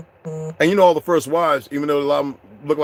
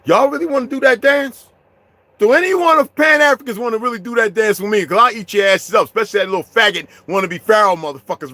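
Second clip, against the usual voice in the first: raised, higher voice daring them; heated challenge; 6 semitones higher, 5 dB louder than usual. Real, not acted. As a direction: throw down the challenge, fired up. Challenging Pan-Africans in a heated rant.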